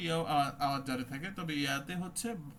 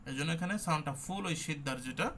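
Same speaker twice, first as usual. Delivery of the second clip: normal talking voice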